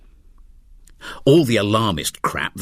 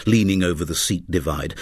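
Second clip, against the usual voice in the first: usual speaking voice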